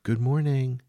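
a cheery hello from the galley; cheerful greeting. A narrator's reading. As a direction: sing out a good morning. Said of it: "She cheerfully called out".

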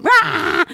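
high loud wordless shriek of fright; scared scream. Retold or imitated. Girls terrified by the knocking, high wordless scream. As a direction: let out a sudden terrified yell. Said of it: "vi råber, og vi skriger".

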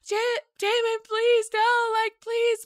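high-pitched pleading wail, imitated; desperate cries. Ex-girlfriend begging Damon to let her stay, desperate cries. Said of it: "desperate cries happen".

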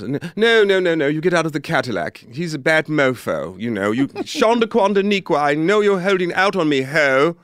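high, loud English director barking orders. Retold or imitated. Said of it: "there is a director from England".

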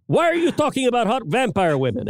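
put-on light Cuban accent, high and fast; imitating his Cuban father, fast and high. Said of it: "my father in a Cuban accent".

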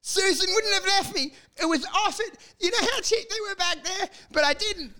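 high, far-off sobbing lament; hammed-up high whiny regret; mock heartbroken whine. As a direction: whimper like a dumped man. Acting the regretful dumped man.

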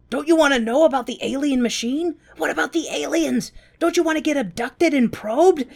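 mock-eager high voice; eager Mumble. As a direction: beg them to stay curious. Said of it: "Mumble is baffled".

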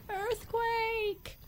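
high startled cry of earthquake; alarmed cry. Acted. Giraffe crying out to the jungle, high alarmed cry. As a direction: shriek it out in alarm. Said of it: "cried Giraffe".

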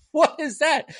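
very high, incredulous question; mock bewilderment. Reviewer reacting to nonsense on screen, mock bewilderment. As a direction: squeal the question in disbelief.

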